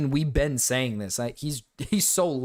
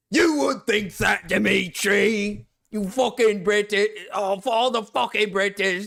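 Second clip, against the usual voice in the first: loud, slow, hammed-up British accent rant; sneering mock British accent, shouted out; 6 semitones higher, 4 dB louder, slower than usual. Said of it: "Stop the accent".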